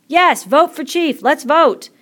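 high, loud, drawn-out cheer; excited slow-stretched call; eager clamour. A narrator's reading. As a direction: shout it like a crowd demanding a vote. Boys clamour for a vote.

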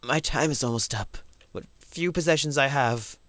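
grave note-reading voice, lightly done; solemn last words, lightly acted; storyteller voice. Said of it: "storyteller voice".

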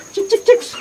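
very high chirpy call; chick call. Real, not acted. Owner calling the last chicks with a very high chirpy call.

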